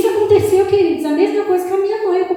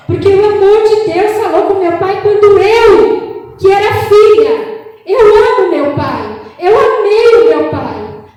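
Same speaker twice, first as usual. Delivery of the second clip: preaching at full force; 3 semitones higher, 5 dB louder than usual. Real, not acted. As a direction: cry it out to the church. Preaching climax with music. (pregação inflamada e alta)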